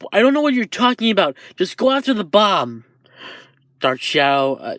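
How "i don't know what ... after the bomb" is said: high, rushed comeback; hurried retort. Acted. Shoji answers while too busy fighting, hurried retort.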